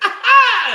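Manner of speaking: high, loud comic "Ah!"; mock straining scream. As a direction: yelp it as if straining, half laughing. Mimics son straining in the bathroom.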